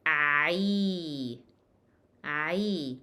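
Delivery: "Ay" from ain into a long ee; drawn-out "Ay", never a bare e; ain with kasra. Shown as a demonstration. Teacher repeats after saying not e, long ay. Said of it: "Not e, by the way".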